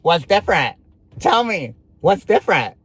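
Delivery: escalating acted interrogation; insistent demand. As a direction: press him harder for an answer. Repeats demand insistently in retold argument.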